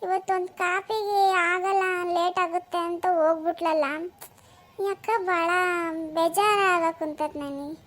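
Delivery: soft, slow and downhearted; mildly hurt musing, slowed down; dejected self-talk. Acted. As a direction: brood quietly to yourself. Left alone, upset over coffee.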